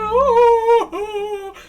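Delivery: loud, high mock wail; hammed-up bawling down the phone; imitated crying. Knitter moved by student's story, mock wailing. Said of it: "to make you cry".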